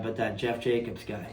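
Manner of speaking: a soft, muttered aside; quiet grumble. Real, not acted. Rider grumbling to hosts about old rival, quietly. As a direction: mutter the rival's name under your breath.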